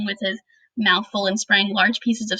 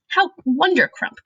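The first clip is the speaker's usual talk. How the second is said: slower, higher delighted cry; beaming cry; 6 semitones higher than usual. A narrator's reading. Giant cries out, delighted by Sophie's praise. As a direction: beam through the word wondercrump. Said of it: "cried the B F G, still beaming".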